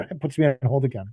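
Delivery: ordinary talk, nothing marked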